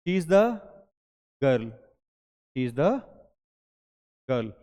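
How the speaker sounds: put-on "the" stressed before "girl"; teacher modelling "the girl" in the example sentence. Shown as a demonstration. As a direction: lean on "the", then drop on "girl". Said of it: "तो क्या बोलूँ".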